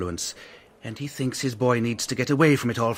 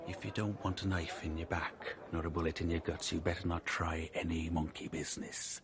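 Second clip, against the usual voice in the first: soft, hushed threat, slightly lower; menacing whisper; 4 semitones lower, 12 dB quieter than usual. Acted. Ryan warning the captain against monkey business, low menacing whisper. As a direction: lean in and breathe the threat.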